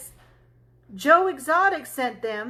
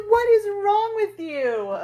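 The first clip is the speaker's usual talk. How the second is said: higher, disbelieving question; incredulous disbelief; 8 semitones higher than usual. Retold or imitated. Wife asking her husband what's wrong with him, incredulous. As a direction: sound like you can't believe him.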